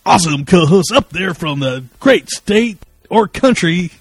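high, loud radio DJ; put-on radio DJ voice, high and loud. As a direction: open the show like a punchy radio DJ. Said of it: "D J voice. So I thought I'd try it".

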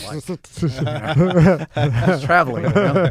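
laughing through the words, slow; amused, laughing it off. Real, not acted. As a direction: chuckle out the answer. Laughing while recalling his answer.

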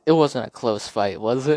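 mock gloat; Ali gloating. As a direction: tease them like a winner. Ali after the fight.

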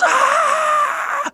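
high, loud horror scream; a sudden shriek of fright; terrified scream. Acted. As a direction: scream as the head looks back. Dan screaming in terror.

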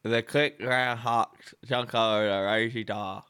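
slow, mumbled through still lips; talking without moving lips. Shown as a demonstration. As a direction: ventriloquist style, lips frozen. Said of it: "try to talk without moving your lips".

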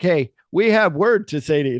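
loud, high demand. Retold or imitated. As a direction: call out loudly. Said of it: "They're talking real loud".

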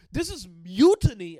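slow, booming outraged yell; furious shout. Retold or imitated. Captain Morgan roaring at his rebelling crew, a slow furious shout. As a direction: bellow it like a betrayed captain.

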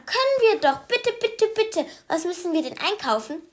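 very high, eager bitte bitte bitte; begging child. Acted. Anton begs his mother, very high and eager. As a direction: beg like an excited little boy.

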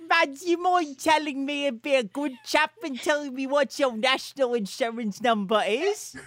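high-pitched, hammed-up British chap; mock British accent. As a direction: wheedle in a silly British accent. Plays a British official demanding numbers.

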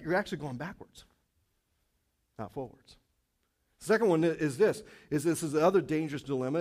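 ordinary delivery

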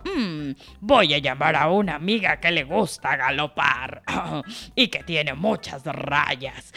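a big lion thinking aloud. Acted. Lion speaks again.

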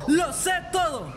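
high, punched-out demonstration; manly shout. Shown as a demonstration. Friend models the confrontation for Paco, manly shout. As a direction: belt it out with conviction. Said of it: "Con voz de hombre".

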